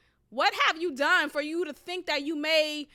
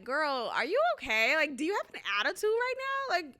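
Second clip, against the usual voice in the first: indignant comeback; 4 semitones higher than usual. Retold or imitated. Acted comeback to rude interviewer.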